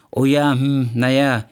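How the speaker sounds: hesitant, halting dodge; evasive fibbing. Acted. Man hiding his injury from photographer, evasive stalling. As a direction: stall as you make up an excuse. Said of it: "log ich irgendwas rum".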